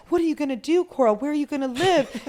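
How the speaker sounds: high, loud, frantic mimicking of worried friends; mock panic. Friends panicking at Coral about losing her home, mock frantic. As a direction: squeal it like everyone's freaking out for you. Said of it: "I had people around me like".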